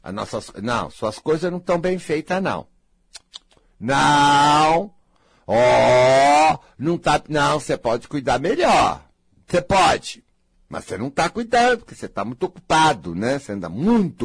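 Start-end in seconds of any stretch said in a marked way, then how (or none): 3.8-10.2 scolding. Acted. Scolding the viewer.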